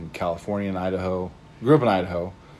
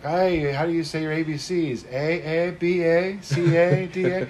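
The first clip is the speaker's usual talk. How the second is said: put-on higher-pitched kids' parody of Canadians; mock Canadian accent; 7 semitones higher, slower than usual. Kids making fun of Canadians, mock Canadian accent. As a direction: sing out a Canadian "eh" after each letter. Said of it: "the way that Canadians talked".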